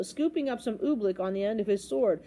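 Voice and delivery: ordinary delivery, nothing marked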